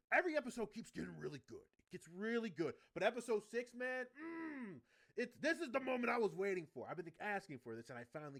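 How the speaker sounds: loud, high, hyped-up gushing; real excitement. Real, not acted. Fan telling viewers about the awaited moment, excited. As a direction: fan bursting with hype.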